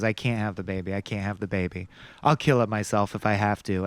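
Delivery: quiet, low retelling of her desperate line; soft low quoting. Retold or imitated. Retelling Geena Davis's refusal, hushed and low. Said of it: "And she goes".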